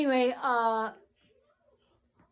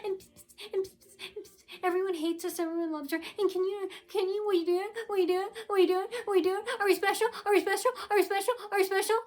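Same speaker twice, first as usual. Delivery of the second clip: high, whiny mocking voice, softer; mocking impression of them; 8 semitones higher, 3 dB quieter, faster than usual. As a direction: mimic them like a sneering child. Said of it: "That's what they're doing. Imitate them".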